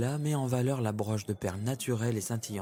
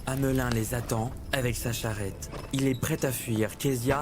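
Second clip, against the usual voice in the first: plain reading voice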